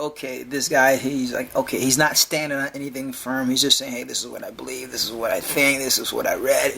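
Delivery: a hint of a meek, unsure believer; humble believer voice. Voicing a modest hypothetical believer.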